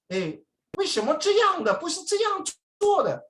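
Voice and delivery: very high-pitched mainland Mandarin mimicry; mainland Chinese accent. Overheard tourist from China objecting, high mainland Mandarin accent. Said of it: "Eh, from China".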